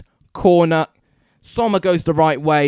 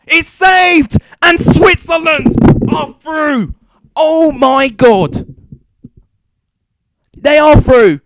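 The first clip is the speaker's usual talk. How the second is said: screaming high and loud with joy; ecstatic yelling; 11 semitones higher, 11 dB louder, slower than usual. Real, not acted. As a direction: scream it, your team just won. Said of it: "sorry if I rip headphone users".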